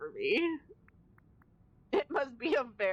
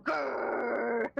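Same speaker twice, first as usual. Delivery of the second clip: mock growl. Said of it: "talking like this".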